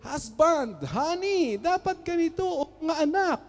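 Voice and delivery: high, sermonizing lecture at home; mock preach at your husband like from the pulpit; wife preaching at husband and kids, mock pulpit tone; preachy pulpit voice to husband. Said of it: "you have in the pulpit".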